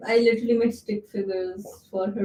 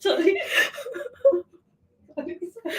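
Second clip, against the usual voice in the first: giggling apology, can't stop laughing; laughing fit; 7 semitones higher, faster than usual. Real, not acted. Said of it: "No, are you okay?".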